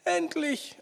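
very high, thin answering cry; high, strained cry of relief; trapped man calling. A narrator's reading. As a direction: call back from far off, without volume.

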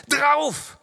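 very high, loud attack shout; battle cry. A narrator's reading. Narrator sending Walker at the enemy, high battle cry. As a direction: order the charge at full voice. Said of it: "rief ich ihm zu".

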